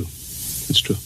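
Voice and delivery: breathy half-whisper of defeat; whispered admission. Acted. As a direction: let it slip out as a whisper. Conceding quietly in conversation.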